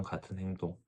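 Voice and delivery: usual speaking voice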